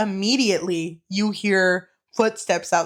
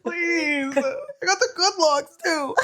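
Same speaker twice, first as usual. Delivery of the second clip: high, laughing mock whine; mock pleading; 7 semitones higher, slower than usual. Joking as worker begging about the good locks, mock pleading. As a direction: plead it half-crying with laughter.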